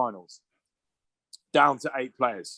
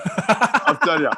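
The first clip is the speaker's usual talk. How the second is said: slow laughing gloat; 7 semitones higher, 5 dB louder, much slower than usual. Retold or imitated. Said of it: "I remember thinking".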